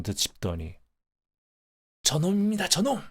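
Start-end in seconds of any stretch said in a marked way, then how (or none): 2.0-3.0 high-pitched agitated outcry; sudden yell. Acted. Old Park hears the express train coming, sudden high yell. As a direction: cry out in alarm, pitch shooting up. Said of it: "고함을 치는 것이었다".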